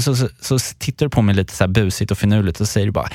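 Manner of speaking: ordinary talk, nothing marked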